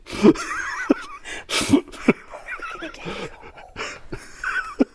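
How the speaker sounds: long high-pitched giggle fit; high squealing laugh. Real, not acted. Guest book entry amuses her.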